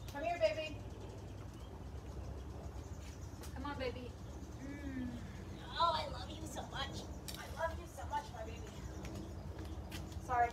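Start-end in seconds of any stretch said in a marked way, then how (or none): 0.1-8.6 soft, tender pet talk; sweet nothings. Real, not acted. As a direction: coo softly to your dog. Cuddling the dog.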